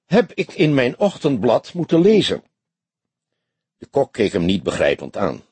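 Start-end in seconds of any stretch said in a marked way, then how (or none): 0.0-2.4 high, tense commissaris; high, put-on voice of an upset chief; hoarse in the story. A narrator's reading. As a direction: complain high and indignant. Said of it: "sprak hij hees".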